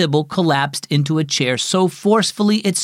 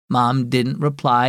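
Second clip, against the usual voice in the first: usual speaking voice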